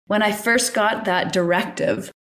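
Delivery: ordinary talk, nothing marked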